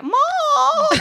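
high wailing call; crying out Mom. Retold or imitated. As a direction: wail for mom. Calling her mom while crying.

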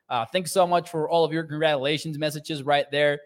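normal talking voice

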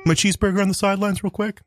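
a coach sneaking a snack; Andy Reid trying to be quiet. Retold or imitated. Andy Reid asking to eat a cheeseburger on the sidelines. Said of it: "Andy Reid eating a cheeseburger".

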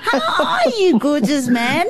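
high, giggly hello through real laughter; laughing greeting. Real, not acted. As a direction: laugh your way through the welcome. Greeting guest while laughing.

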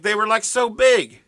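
put-on New York accent. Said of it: "And he was like".

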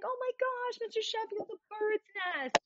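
high thrilled squeal; excited squeal. Retold or imitated. Fran quoted, excited.

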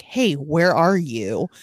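ordinary delivery

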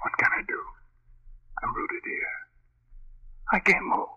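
breathy whisper breaking into a cry; helpless terror, frozen in place; paralysed whisper. Acted. Chuck tells his wife he cannot move, whispered terror.

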